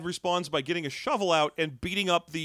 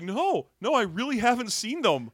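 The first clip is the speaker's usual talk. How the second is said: put-on higher-pitched kid protesting; insistent kid; 7 semitones higher than usual. Kid refusing the man who grabbed him, insistent. Kid's indignant refusal, acted.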